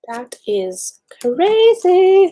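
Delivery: drawn-out, high, loud amazement; amazed. Real, not acted. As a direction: stretch it out, high and loud, really impressed. Browsing the creator's old profile.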